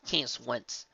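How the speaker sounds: ordinary delivery, nothing marked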